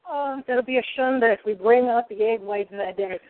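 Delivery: ordinary talk, nothing marked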